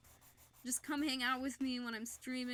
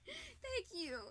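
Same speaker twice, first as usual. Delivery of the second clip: high, soft, choked-up thank you; tearful thanks; 12 semitones higher, 6 dB quieter than usual. Real, not acted. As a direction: thank them through real tears. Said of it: "you can actually see me crying".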